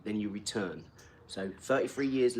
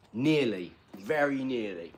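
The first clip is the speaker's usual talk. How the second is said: normal talking voice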